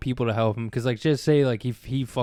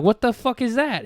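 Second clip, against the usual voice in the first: high squeaky baffled shout; high confused yell; 11 semitones higher, 4 dB louder than usual. Retold or imitated. Kid on the roof yelling back at dad: high baffled shout. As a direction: pitch it way up, totally lost.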